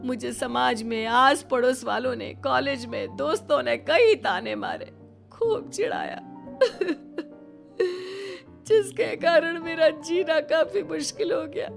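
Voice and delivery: high, sobbing lament; tearful outpouring. Acted. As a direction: pour out old hurt through sobs. Tearful confession of mockery suffered.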